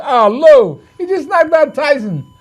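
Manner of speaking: high-pitched mock Lasorda protest; Tommy Lasorda. Lasorda yelling to Lou from the aisle, high and incredulous. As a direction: squeak it out like an old buddy scoffing. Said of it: "he's hollering over to me".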